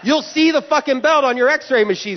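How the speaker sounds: high, indignant tirade; worked-up comic complaint at airport security; exasperated rant. Acted. Ranting at imagined screener.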